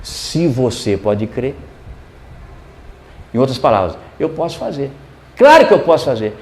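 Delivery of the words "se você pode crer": slow, low, softer pronouncement; measured categorical reply, pitched down. Retold or imitated. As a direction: state it categorically, slowly and quietly. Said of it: "E Jesus foi categórico".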